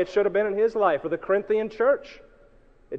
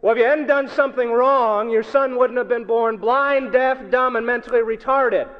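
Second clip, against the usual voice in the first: loud, raised-pitch blame; accusing; 5 semitones higher, 5 dB louder than usual. Acted. Church member blaming grieving parents, loudly accusing. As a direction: hammer out a judgmental accusation.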